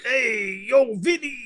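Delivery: drawn-out mock New York call; hammed-up New York accent. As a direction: hail a pal in put-on New York. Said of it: "Yankee accent, uh, New York".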